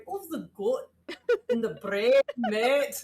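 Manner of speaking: playful fake Australian accent drifting Scottish, with a laugh; mock Australian accent. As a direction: ham up an Australian accent that slides toward Scottish. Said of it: "why does she lean Scottish?".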